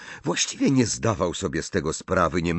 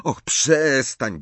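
higher, drawn-out plea; pleading; 7 semitones higher, 3 dB louder, much slower than usual. A narrator's reading. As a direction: beg her to stop, stretching the word. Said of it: "błagał ją Ron". (błagalnie, wysoko i przeciągle)

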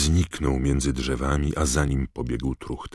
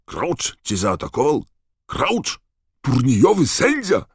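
shrill rising disbelief, much higher and louder; incredulous; 12 semitones higher, 8 dB louder than usual. Acted. Karkarov hearing Crouch attacked his student, incredulous shriek.

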